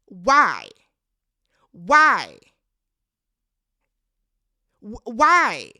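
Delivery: loud shouted why, over and over; screaming why. Retold or imitated. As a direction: yell it at the TV in disbelief. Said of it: "the way I was screaming at her".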